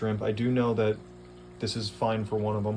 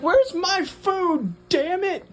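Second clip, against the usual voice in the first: much higher, very slow, louder demanding squeak; voicing the fish; 6 dB louder, much slower than usual. Owner voicing her aggressive fish demanding food, high and slow. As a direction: play a bossy hungry little fish. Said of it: "She's like".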